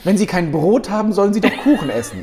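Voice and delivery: hochnäsige Königin, höher und lauter; Marie Antoinette. Acted. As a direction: wie eine verwöhnte Königin deklamieren. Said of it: "die Marie Antoinette der Inklusion". (blasierte Königin, laut und lachend)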